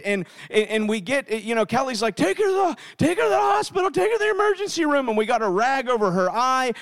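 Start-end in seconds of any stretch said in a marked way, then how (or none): 2.1-5.1 high, urgent retold panic; frantic, panicked. Wife urging a hospital trip for their daughter, panicked. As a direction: repeat the order higher, rushing at the end. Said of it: "we're frantic, and we're panicked".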